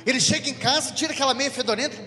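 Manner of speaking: parishioner's wife venting to the priest about her husband; angry wife. Retold or imitated. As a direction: rant like a fed-up old wife. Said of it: "ela tava meio brava".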